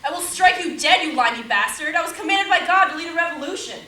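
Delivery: loud, angry threat hurled across the table; furious threat. Acted. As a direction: strike him down with your voice. Joan threatens Thomas in quarrel.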